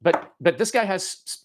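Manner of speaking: ordinary delivery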